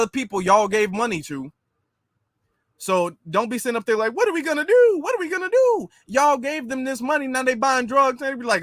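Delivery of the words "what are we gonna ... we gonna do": high mock-panicked wail. Mocking leaders who gave the money, high panicked whine. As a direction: wring your hands in a high mock whine.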